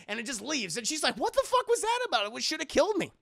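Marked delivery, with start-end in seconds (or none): 1.2-3.1 high-pitched mock disbelief; imitating someone totally baffled; baffled outburst. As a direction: exclaim in exaggerated bewilderment, high and quick. Baffled survivor in retold scene.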